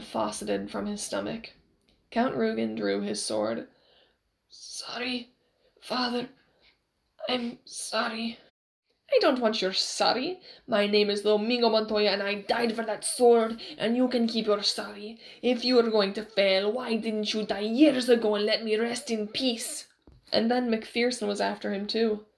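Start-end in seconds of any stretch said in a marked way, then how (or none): 4.5-8.4 slow, halting near-whisper; weak apology. A narrator's reading. Wounded son to his dead father, faint apology. 9.1-19.9 put-on loud, forceful accented tirade; angry rebuke. A narrator's reading. Dead father scolds his failing son, angrily.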